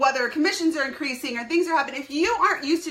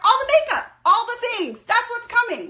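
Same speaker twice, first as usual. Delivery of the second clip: excited; 9 semitones higher, 4 dB louder than usual. Real, not acted. Excited answer about products.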